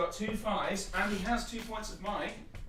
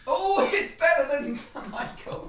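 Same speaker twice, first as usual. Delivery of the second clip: laughing; 9 semitones higher, 8 dB louder, slower than usual. Real, not acted. Laughing at the dice roll.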